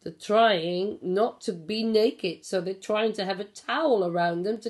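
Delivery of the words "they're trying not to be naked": usual speaking voice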